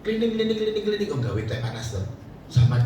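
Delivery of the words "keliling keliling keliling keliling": high, rapid tinkling sound; imitated clinking. Wife making hot tea for guests, imitated clinking. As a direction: mimic a spoon clinking in cups.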